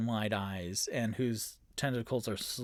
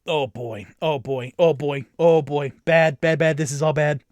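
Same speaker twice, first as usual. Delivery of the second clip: repeated worried exclamations, building louder; mounting alarm; 9 dB louder than usual. Real, not acted. Game master seeing the fight go wrong, increasingly loud dismay.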